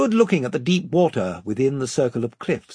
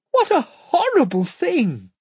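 horrified; 13 semitones higher, 4 dB louder than usual. Acted. Lucy horrified.

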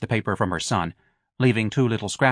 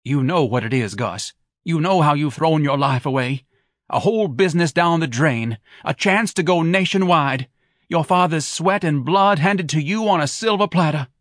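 higher, louder nagging tirade; Mrs Levy heated; 7 semitones higher, 4 dB louder than usual. Acted. Wife berates husband for wasting the family business, higher and louder. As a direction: pile the accusations on him.

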